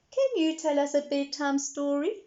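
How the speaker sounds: put-on high eager little girl. A narrator's reading. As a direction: ask sweetly like a small girl. Peppa voiced.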